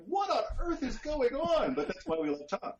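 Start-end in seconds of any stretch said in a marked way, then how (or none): 0.1-1.7 much higher and slow, baffled; mock bewilderment. Reacting to Tom's odd slang, mock baffled. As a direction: play up total confusion. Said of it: "I'm like".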